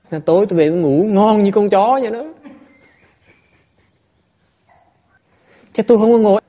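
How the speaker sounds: loud, high, driving sermon voice; impassioned Dharma talk; fervent preaching. Acted. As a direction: hammer the point home to the hall. Preaches at full force.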